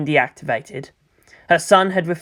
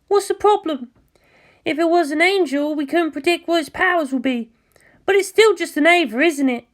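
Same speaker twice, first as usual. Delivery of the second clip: put-on higher, shy and eager-to-please girl; timid pilot Mayumi; 12 semitones higher than usual. Mayumi answering Asuka over the comm, higher shy voice. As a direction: voice Mayumi high and hesitant, like a nervous junior.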